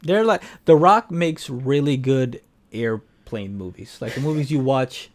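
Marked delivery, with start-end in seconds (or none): none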